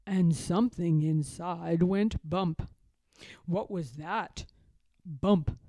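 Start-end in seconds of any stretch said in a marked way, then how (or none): none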